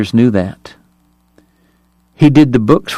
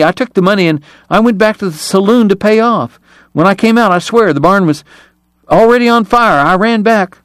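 frightened pleading; 8 semitones higher than usual. A narrator's reading. Eli pleads at gunpoint.

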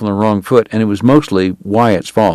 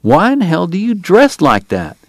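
slow, higher, honestly baffled question; genuinely puzzled; 9 semitones higher than usual. A narrator's reading. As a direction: ask like you truly can't fathom it. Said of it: "genuinely puzzled".

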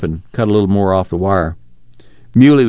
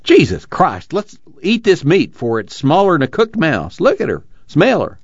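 high-pitched, edgy exclamation; nervous cry; 7 semitones higher than usual. A narrator's reading. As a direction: urge them to eat, voice jumping up nervously. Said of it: "Jode cried nervously".

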